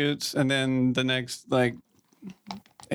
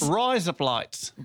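higher Australian "Rise, up, lights", run together; Australian demo for a giggling learner; Aussie accent; 4 semitones higher, much slower than usual. Shown as a demonstration. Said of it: "in your best Aussie accent".

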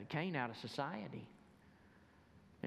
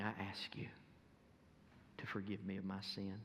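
soft, hushed prayer voice; 6 dB quieter than usual. Acted. Preacher leading listeners in a prayer of salvation, hushed.

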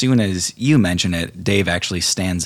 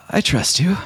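higher, timid confession; timid fey lover; 11 semitones higher than usual. Acted. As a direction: say it shyly, trusting her.

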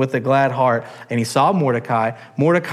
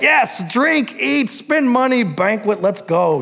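high, gleeful party king; giddy royal yes; eager drunk king; 7 semitones higher than usual. Acted. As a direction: cheer it like a king ready to feast. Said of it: "the king is like".

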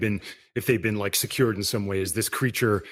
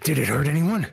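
louder, higher urgent question; urgent in her face; 4 semitones higher, 4 dB louder than usual. Acted. Vampire pressing Dylan up close, urgent and loud. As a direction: lean in and demand it. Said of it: "I'm sitting up in her face".

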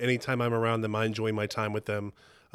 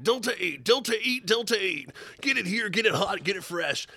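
monster truck ad; 9 semitones higher than usual. Retold or imitated. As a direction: hype it like a monster truck commercial. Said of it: "it was like a monster truck advertisement for Delta eight".

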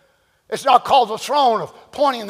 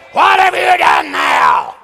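loud, higher, slow furious rebuke; angry God scolding; 7 semitones higher, 7 dB louder, slower than usual. Retold or imitated. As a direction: thunder at the sinner like a wrathful judge. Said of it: "That's the way we picture God".

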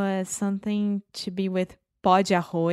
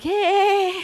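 high, loud drawn-out whoop; retold cheer; 12 semitones higher, 7 dB louder than usual. Brazilians hearing samba at concert, excited cheer. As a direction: cheer like a thrilled fan.

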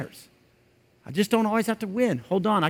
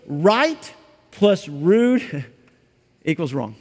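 slow, weighty statement; slow emphatic; 3 semitones lower, much slower than usual. Shown as a demonstration. Preacher drives home that right plus rude equals wrong, slow and weighty. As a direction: lay it down slowly, word by word. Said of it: "Tone matters".

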